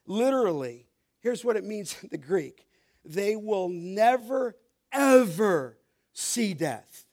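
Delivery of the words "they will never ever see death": NEVER drawn out slowly, then EVER slow and weighty; emphatic never ever. Shown as a demonstration. Preacher showing what the Greek means, slow emphatic stress. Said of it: "It's emphatic, we call it".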